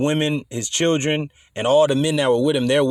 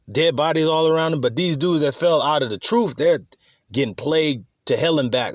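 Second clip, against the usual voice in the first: usual speaking voice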